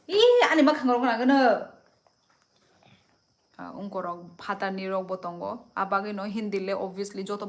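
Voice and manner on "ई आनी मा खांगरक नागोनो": sudden high, very loud cry; loud outburst. Real, not acted. Livestreamer breaks off reading comments, loud outburst. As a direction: burst out at full voice, pitched high.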